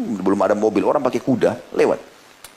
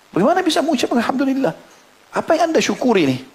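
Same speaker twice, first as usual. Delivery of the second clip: astonished disbelief. Acted. Astonished listener questions father.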